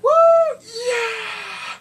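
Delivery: high, loud "yeah" of delight; cheering shout. Real, not acted. Cheering as the police arrest the mother, loud shout. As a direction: shout it like your team scored.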